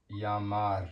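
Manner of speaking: "llamar" starting with an English Y sound; double L as Y. Shown as a demonstration. Teacher giving an example of two L's, "llamar" with a Y sound. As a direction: say "llamar" as yah-MAR, no L heard. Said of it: "Y sound".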